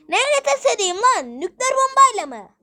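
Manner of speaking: high, loud exasperated retort; indignant shout. Acted. Producer yelling back at his accuser, indignant. Heated argument with friend.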